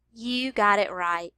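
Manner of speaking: ordinary delivery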